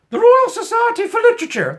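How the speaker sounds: high, loud plummy toff voice; hammed-up upper-class mockery; mock posh. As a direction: announce it like a pompous aristocrat. Mocking posh institution name.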